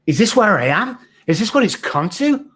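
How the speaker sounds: high, stunned questions to himself; disbelieving despair. Retold or imitated. Man on the streets asking himself how he fell, high and stunned. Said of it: "And I'm like".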